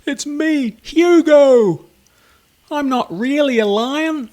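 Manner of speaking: high, loud pleading call; shouting. Acted. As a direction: shout it desperately after the fish. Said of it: "he shouted".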